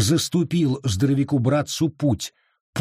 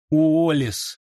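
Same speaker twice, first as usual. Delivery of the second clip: higher-pitched imploring call; pleading; 4 semitones higher than usual. A narrator's reading. Edward begs his brother for mercy, pleading. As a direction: appeal to him by name. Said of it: "взмолился Эдвард".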